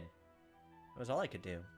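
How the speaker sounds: ordinary talk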